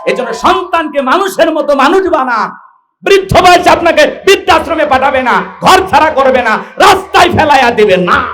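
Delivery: high, loud, hammering preaching; full-force denunciation. Acted. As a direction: denounce them to the crowd at full voice. (উঁচু গলায় তীব্র ওয়াজ)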